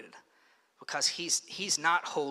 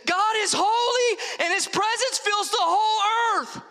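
very high, loud awestruck proclamation; all-out high shout, overwhelmed by glory; overcome shouting; 5 dB louder than usual. Acted. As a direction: scream it out like freaking-out seraphs. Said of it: "they're just shouting this out".

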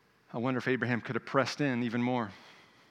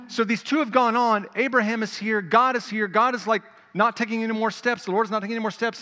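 loud, high, driving sermon voice; preacher building the scene for the congregation, loud and fervent; full-force preaching; 9 semitones higher, 7 dB louder than usual. Acted. Pastor narrating Abraham and God face to face, fervent preaching.

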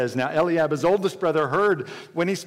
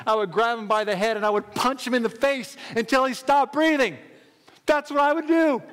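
raised, forceful voice building to a peak; fired-up boast; 3 semitones higher than usual. Acted. David boasts to Saul of fighting lions, fired up.

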